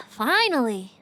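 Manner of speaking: usual speaking voice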